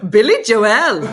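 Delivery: loud mock outburst of an audiobook reader; Dean. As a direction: blurt it out like a sudden interruption. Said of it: "here's Dean".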